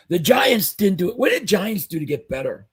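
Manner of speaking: ordinary delivery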